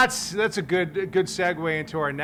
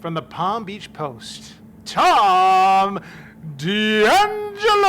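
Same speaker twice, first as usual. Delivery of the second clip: announcer intro; 4 semitones higher, 5 dB louder, much slower than usual. Acted. Announcing the next guest.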